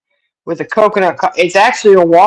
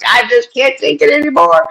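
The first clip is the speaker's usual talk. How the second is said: higher, whiny cat voice put on for fun; voicing the cat; 9 semitones higher than usual. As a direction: play the fed-up cat, pitched up. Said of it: "he was like".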